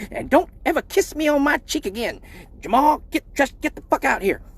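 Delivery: high scolding mom; high hammed-up mom scolding; angry mom voice. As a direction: scold like his mom kicking the door in.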